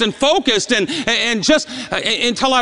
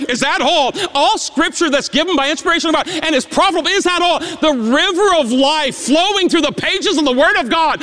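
builds higher and faster at each is that all; rising preaching; 4 semitones higher than usual. Acted. Preacher repeating the taunt back to the congregation, rising. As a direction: preach it up to full force.

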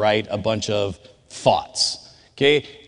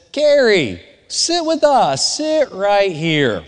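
calling out; 7 semitones higher, much slower than usual. Retold or imitated. Friend calls girl to table.

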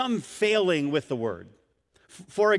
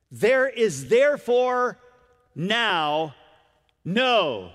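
slow, loud stress on therefore; preacher pounding each word of the verse; emphatic reading; 3 semitones higher, 4 dB louder, much slower than usual. Shown as a demonstration. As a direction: lean hard on therefore, then now, then no. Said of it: "I can't overemphasize how strongly".

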